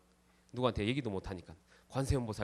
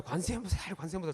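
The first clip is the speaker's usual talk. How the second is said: mock shouting; 7 semitones higher than usual. A narrator's reading. Wife on the roof crying out to the heavens, shouting. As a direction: yell the prayer upward, pointing in anger. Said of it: "소리를 막 지릅니다".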